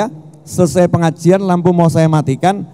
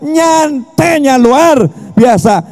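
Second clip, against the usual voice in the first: high, raised preaching voice, slowing at the end; fervent preaching; 6 semitones higher, slower than usual. Real, not acted. Preacher urging the crowd to know God, raised fervent voice. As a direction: keep the fire going while listing each word. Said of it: "teriak-teriak".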